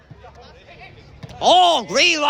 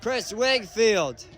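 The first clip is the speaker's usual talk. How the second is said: ordinary talk, nothing marked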